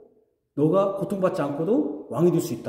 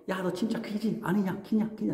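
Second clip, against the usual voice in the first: softer, sneering taunt; mocking jeer; 9 dB quieter than usual. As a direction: tease him with a smirk. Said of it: "조롱". (낮게 빈정대는 조롱)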